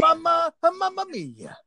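put-on very high Mario-style Italian accent; cartoon Italian. Host riffing on the Italian bit in a high Mario voice. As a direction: squeal it like a cartoon Italian plumber.

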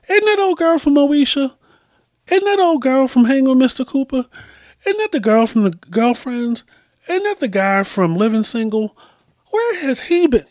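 high-pitched, excited recognition of familiar faces; excited TV pointing. Acted. Viewer pointing at actors on the TV, excited. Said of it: "pointing at the T V like Leo DiCaprio".

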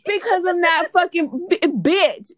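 heated, exasperated yell. Real, not acted. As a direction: fire back loudly, voice jumping up. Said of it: "Why are you screaming?".

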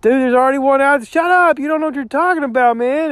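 hammed-up scolding from the audience; mock viewer yelling. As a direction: yell him down like an annoyed know-it-all. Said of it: "you're screaming at me going".

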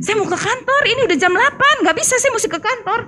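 wriggle out with a flustered excuse. Retold or imitated. Wife to husband, avoiding the talk, high and flustered.

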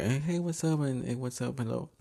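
lightly imitated high, soft hello; mock friendly greeting. Acquaintance greeting her with a hug, mock friendly.

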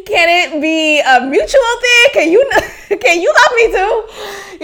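high-pitched plea through laughter; plead, cracking up as you go; laughing plea. Real, not acted. Laughing plea to be loved back.

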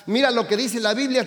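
ordinary talk, nothing marked

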